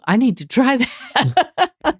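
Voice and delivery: amused imitation of an impressed manager; laughing retold line. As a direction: quote it and crack up at the end.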